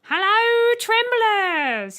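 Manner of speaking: high, loud cheery shout; shouted greeting. A narrator's reading. Robot bursting into the cockpit, loud high shout. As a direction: burst in yelling hello. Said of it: "he shouted".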